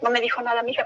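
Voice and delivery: ordinary talk, nothing marked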